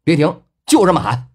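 hearty shouted encouragement; cheering her on. A narrator's reading. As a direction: push her to keep going. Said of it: "继续的给林初音打气儿".

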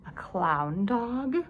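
slow, drawn-out disdain rising into a question; haughty, incredulous sniff; sniffy. A narrator's reading. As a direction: stretch it out in snobbish disbelief. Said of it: "sniffed Pasha".

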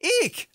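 high squealing eek; startled eek. Acted. Knight shrieks as the bat appears, startled high squeal. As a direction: shriek in fright.

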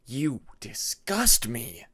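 slow, cold contempt; cold accusing voice. A narrator's reading. Dream voice sneering at Peacemaker, slow contempt. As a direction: spit out the disgust slowly.

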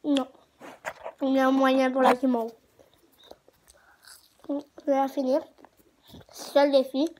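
talking while biting candy; chewing. Real, not acted. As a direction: mouth full of marshmallow.

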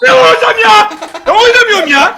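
loud high frustrated yelling; frustrated shout. Real, not acted. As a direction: yell in frustration. Conceding, upset.

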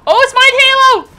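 high, loud burst of excitement; excited shout. Real, not acted. Player spotting something of theirs in game, excited shout. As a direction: blurt it out loudly, way up high.

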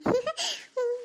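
high, quiet laugh; soft giggle. Acted. Alone with new gifts, a pleased soft giggle. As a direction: giggle softly to yourself.

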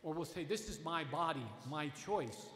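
ordinary talk

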